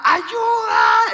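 high, hammed-up distress shout; shouting for help. Couple reaching the water yelling to a boat, acted shout. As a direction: yell for rescue at full volume. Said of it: "Empezamos como a gritar desde antes".

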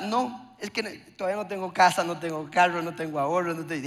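mock a man listing why he can't marry; mock excuses.

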